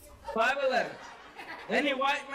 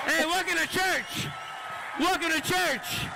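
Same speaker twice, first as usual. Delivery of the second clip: high, projected announcer shout; rally the room like a hype man; hype-man call-out; 6 semitones higher than usual. Pretend. Comic welcoming the crowd to church, hyped calling out.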